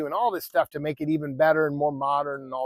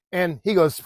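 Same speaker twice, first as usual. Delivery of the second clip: plain reading voice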